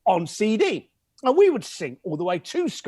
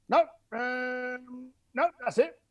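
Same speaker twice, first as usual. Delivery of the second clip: imitating the caller; 7 semitones higher, slower than usual. First caller answering, voiced.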